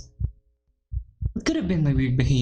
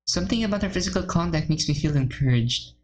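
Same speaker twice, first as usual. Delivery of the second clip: ordinary delivery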